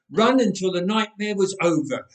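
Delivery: ordinary delivery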